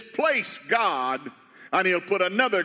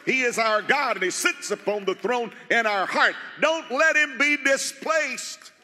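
fervent preaching; 4 semitones higher than usual. Real, not acted. Pastor warning the church about idols on the throne, urgent preaching. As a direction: lift the warning up over the church.

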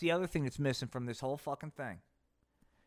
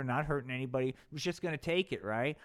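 ordinary delivery

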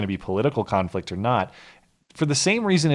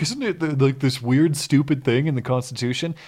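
mocking tone; 4 semitones higher than usual. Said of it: "they always present it like".

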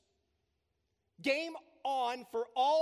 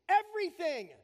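"Everything" higher and louder, punched out; emphatic exclamation; 8 semitones higher, 7 dB louder than usual. Shown as a demonstration. Pastor joking about his exclamation marks, "Everything" shouted out. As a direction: hit "Everything" like a triple exclamation mark. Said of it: "Everything else is a single exclamation mark".

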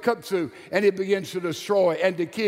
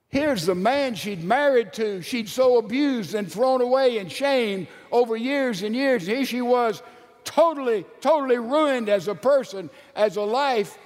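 raised, higher preaching swell; impassioned preaching; 6 semitones higher than usual. Acted. Preacher describing Gomer's ruin, impassioned swell. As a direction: build the ruin of Gomer with rising fervor.

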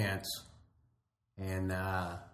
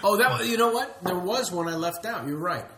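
excited; 9 semitones higher, 8 dB louder than usual. Retold or imitated. Excited recall of director's order.

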